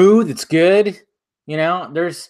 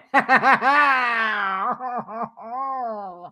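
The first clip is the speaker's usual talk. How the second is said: high laugh dissolving into fake sobs; laugh, then draw it out into a comic wail; laugh into mock wail; 8 semitones higher, 4 dB quieter than usual. Host facing a second viewing, laughing into mock crying. Dreads seeing dull film again.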